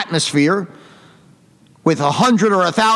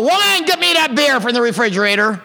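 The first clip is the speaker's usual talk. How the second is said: imitating a demanding husband yelling, higher-pitched; raised, strained holler without real volume; mock yell; 4 semitones higher than usual. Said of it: "And then they yell".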